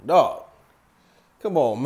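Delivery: usual speaking voice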